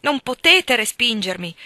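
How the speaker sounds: loud, passionate cry; ardent exclamation. A narrator's reading. Hargrave cries out to Helen with passion, loud. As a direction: throw it at her with fervour. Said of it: "esclamò con ardore".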